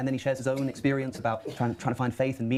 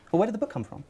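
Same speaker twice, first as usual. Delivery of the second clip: puzzled questioner; 10 semitones higher than usual. Retold or imitated. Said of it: "And someone says".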